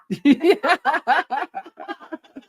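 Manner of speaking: high, long cackling laugh; real laugh. Real, not acted. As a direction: burst out laughing at length. Laughs at the accent demo.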